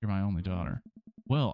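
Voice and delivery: usual speaking voice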